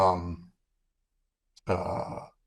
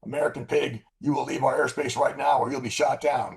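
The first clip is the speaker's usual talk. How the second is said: radio warning; 6 semitones higher than usual. Retold or imitated. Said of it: "and they say".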